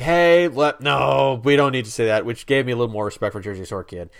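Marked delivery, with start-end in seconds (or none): 0.0-2.3 firm objection. Retold or imitated. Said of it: "Jersey Shore Kid's like".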